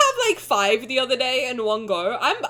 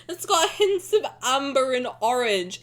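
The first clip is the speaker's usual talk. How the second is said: ordinary talk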